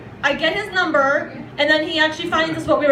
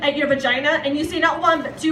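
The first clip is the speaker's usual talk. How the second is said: ordinary talk, nothing marked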